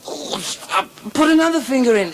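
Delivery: high, loud and quick; woman in ecstasy. Acted. Woman urging her lover on, high and excited. Woman in retold bedroom joke.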